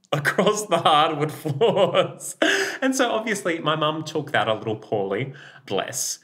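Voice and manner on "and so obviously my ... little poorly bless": ordinary talk, nothing marked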